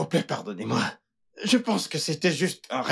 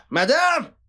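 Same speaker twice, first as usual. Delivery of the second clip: crie plus fort et plus aigu; appel crié; 11 semitones higher, 6 dB louder than usual. Acted. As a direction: hurle pour qu'on l'entende. Calling out loudly. (la domestique appelle sa patronne en criant)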